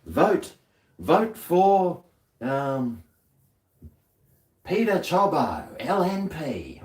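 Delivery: high, announcing slogan voice; election slogan voice. Retold or imitated. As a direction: read it like a campaign shirt. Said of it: "It was all like".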